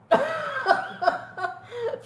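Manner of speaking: a burst of real high laughter; breaking into a high giggle. Real, not acted. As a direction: laugh out at how absurd it was. Laughing at own story.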